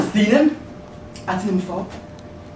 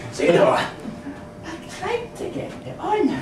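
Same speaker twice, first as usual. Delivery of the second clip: ordinary talk, nothing marked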